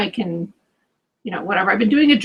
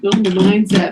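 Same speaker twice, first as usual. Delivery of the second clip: mocking scoff; 5 dB louder than usual. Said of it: "I know it sounds all like".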